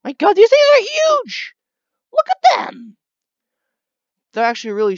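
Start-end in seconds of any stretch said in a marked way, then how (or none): 0.0-3.0 hammed-up amazed exclamation, much higher and louder; over-the-top fake awe, high and loud; mock amazement. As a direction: pretend these tiny critters are giants. Mock amazement at tiny animals.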